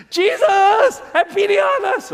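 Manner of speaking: high, loud desperate shout for pity; desperate cry. Shown as a demonstration. Preacher shows congregation the lepers' desperate shout, high and loud. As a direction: cry out across the distance. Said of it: "That's how it sounds like".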